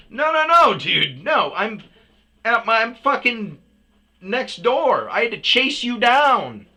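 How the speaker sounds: loud, high, exasperated yelling; angry protest. Retold or imitated. Customer stopping driver in parking lot, angry loud protest. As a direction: cut them off with heated objections.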